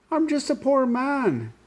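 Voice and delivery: high, slow, whiny plea; whining leprechaun. Acted. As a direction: whine like a caught little man. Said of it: "wiggled and twisted and whined".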